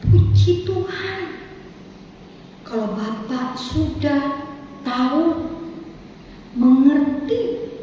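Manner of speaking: very slow, calm and measured; slow gentle reply. Retold or imitated. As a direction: answer gently, drawing each word out. Pastor answers gently.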